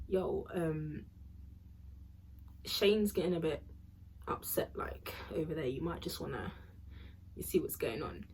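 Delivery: low, hushed, half-whispered warning; hushed tip-off. Retold or imitated. Quietly tipping off Shane's auntie, hushed and discreet. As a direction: murmur it so Shane won't hear.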